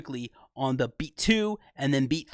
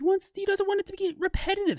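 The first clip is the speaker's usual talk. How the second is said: excited. Real, not acted. Excited explanation.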